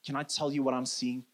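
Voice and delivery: soft, low, gentle plea to a friend; loving. Acted. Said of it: "but in a loving way".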